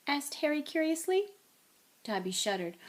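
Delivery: ordinary delivery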